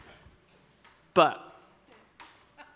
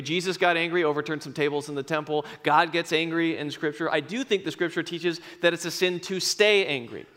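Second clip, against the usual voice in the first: usual speaking voice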